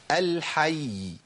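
الحي with its final y held long; very slow الحي, drawn out at the end; lengthened final ya. Shown as a demonstration. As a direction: stop on الحي and sustain the doubled y. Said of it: "لازم أطول الزمن شوية".

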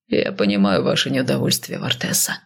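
lightly voiced low male character; smirking man. A narrator's reading. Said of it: "ухмыльнувшись, мужчина откинулся".

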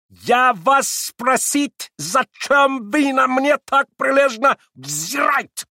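high, slow, shouted with broken Russian; furious German accent. A narrator's reading. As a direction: yell it like an outraged German. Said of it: "прокричал он с удвоенной яростью".

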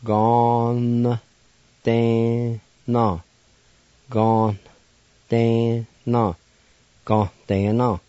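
Gon, ten, no spoken slowly, syllable by syllable, with the N nasalized; slow Cherokee syllables. Shown as a demonstration. Radio teacher to listeners, sounding out Gon ten no slowly. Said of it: "may be pronounced like this".

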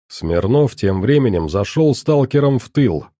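ordinary delivery

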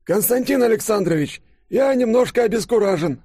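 put-on very high, bewildered old professor; flustered, high-pitched elderly character; Лыков. A narrator's reading. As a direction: protest in a reedy high old man's voice. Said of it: "Профессор Лыков, стоящий рядом, наконец открыл рот".

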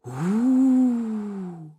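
drawn-out wind howl; eerie wailing wind. A narrator's reading. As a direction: howl like a cold wind in the forest. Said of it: "В спину завывал колючий ветер".

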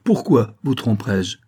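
loud, indignant questioning; haughty challenge. A narrator's reading. Saphir sizes up the vicomte, haughty and indignant. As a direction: challenge his right to ask. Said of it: "en le toisant des pieds à la tête".

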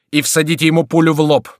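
high, loud military order; commanding. A narrator's reading. Crillon ordering guards to find the shouter, commanding. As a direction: order the men firmly. Officer orders his men to shoot.

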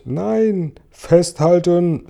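high, drawn-out warning cry; urgent shout. A narrator's reading. James warns everyone before the crash, urgent shout. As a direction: yell it, stretching the words slowly. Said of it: "schrie James".

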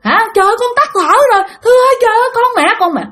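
very high, desperate mother's wail; screaming for help. Retold or imitated. Mother calling over lifeless child, screaming for help. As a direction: imitate a frantic mother pleading and crying out. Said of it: "Cái mẹ của bạn á thì la làng".

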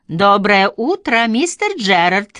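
loud, slow, cheerful hello; cheerful greeting. A narrator's reading. Nurse greeting old Gerard at the gate, loud and cheerful. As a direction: call out brightly to the old man. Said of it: "весело поздоровалась".

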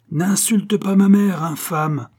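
high, slow, loud warning; outraged. A narrator's reading. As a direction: forbid the insult with fury. Outraged defence of mother. (indignation furieuse)

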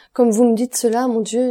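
hurt outburst, easing on the last words; wounded exclamation. A narrator's reading. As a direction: cry out as if stung by the words. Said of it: "s'écria Valentine blessée".

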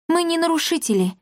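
high-pitched scared little voice; frightened squeak. A narrator's reading. Darina pleading with the ghosts, frightened squeak. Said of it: "испуганно пискнула Дарина".

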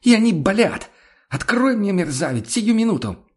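very high, furious squealed demand; hoarse shriek. A narrator's reading. Woman outside orders the man to open up, hoarse shriek. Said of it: "Сорвавшись на хриплый визг".

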